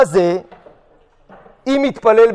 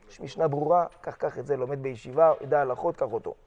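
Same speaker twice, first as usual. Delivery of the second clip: low, very soft aside; hushed advice; 7 semitones lower, 13 dB quieter than usual. Acted. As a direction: confide it quietly, voice dropped low. Acted hushed advice to the gabbai.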